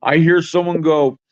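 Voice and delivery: normal talking voice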